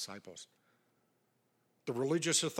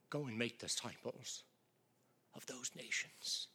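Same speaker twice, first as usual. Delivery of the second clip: hushed; 3 semitones lower, 8 dB quieter than usual. Real, not acted. Hushed reading of the commission.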